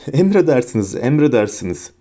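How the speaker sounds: ordinary delivery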